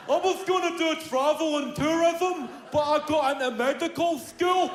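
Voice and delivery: high-pitched dim student voice; mock lucky student. Lucky pupil boasting on the telly, high mock-student voice. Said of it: "Put them on the telly".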